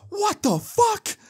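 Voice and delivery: very high, drawn-out mock shriek; imitated shocked mom. Mom hears how much was stolen, mock shocked shriek. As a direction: stretch it slowly in a squealing falsetto of disbelief.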